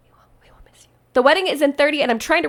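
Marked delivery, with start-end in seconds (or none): 0.1-0.9 soft breathy whisper aside; whispered aside to the listeners. Real, not acted. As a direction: drop to a secret whisper. Aside whispered after plain line.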